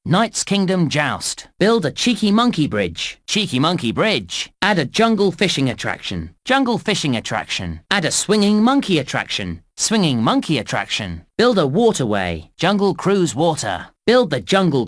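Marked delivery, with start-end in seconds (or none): none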